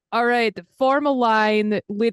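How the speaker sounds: exasperated marshal. Acted. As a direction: take charge like a weary queue steward. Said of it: "roll her eyes a little bit and be like".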